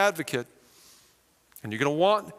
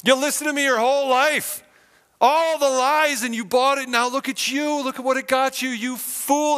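much higher and louder sneering taunt; mocking accuser; 9 semitones higher, 5 dB louder than usual. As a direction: jeer at the sinner like a gloating devil. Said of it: "He's like".

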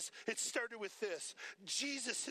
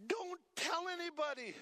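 Jesus's instruction; 7 semitones higher, 3 dB louder than usual. Retold or imitated. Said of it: "Jesus says to him".

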